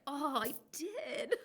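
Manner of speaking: high, slow oh of realisation; sheepish realisation. Retold or imitated. Admitting to husband she asked for this, slowly. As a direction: draw it out high as it dawns on you.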